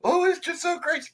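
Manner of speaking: high, wailing mock breakdown; frantic coworker. Mimicking a girl having a breakdown in the corner, high wail. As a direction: wail like someone falling apart.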